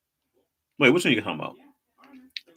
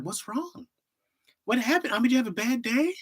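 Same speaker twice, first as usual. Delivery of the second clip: put-on high-pitched babying worry; hammed-up poor-baby voice; mock concern; 13 semitones higher than usual. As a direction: coo with exaggerated sympathy. Acts concern at crying emoji.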